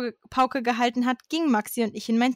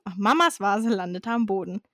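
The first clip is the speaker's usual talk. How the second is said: usual speaking voice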